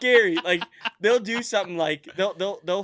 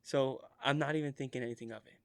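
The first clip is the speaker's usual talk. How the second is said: ordinary talk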